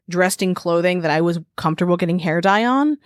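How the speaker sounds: ordinary delivery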